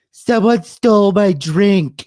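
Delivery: high, loud, slow mocking wail of a stranger; mock complaining girl. Mimicking the girl at the bar who lost her drink, loud high mock wail. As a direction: ham up her outraged cry, slowed and high. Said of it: "I hear this bitch just go".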